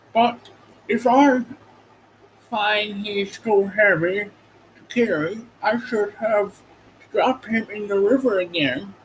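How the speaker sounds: a hint of a cautious stork; stork's voice. A narrator's reading. Stork warning Dorothy she may drop him, lightly voiced.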